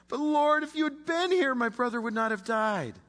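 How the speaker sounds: high, loud pleading cry; pleading Mary. Acted. Mary at Jesus' feet, crying out high and pleading.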